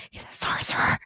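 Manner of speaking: hushed whisper of a secret; whispered reveal, waking from fake sleep. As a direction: breathe it out as a stunned secret. Fakes sleep and reveals Merlin.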